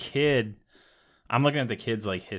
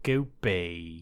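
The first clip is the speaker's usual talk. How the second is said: Louis from Pikmin; 7 semitones lower, 6 dB quieter than usual. Shown as a demonstration. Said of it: "sounds like you're fucking Louis from Pikmin".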